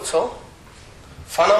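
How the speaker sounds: plain reading voice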